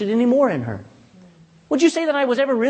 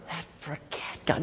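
whisper; 5 semitones lower, 15 dB quieter than usual. Real, not acted. Completing the verse with the congregation.

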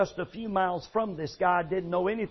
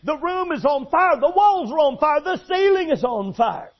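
higher, louder, insisting; adamant shout; 7 semitones higher, 6 dB louder than usual. Retold or imitated. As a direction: hammer home that the room is burning. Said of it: "adamant about it".

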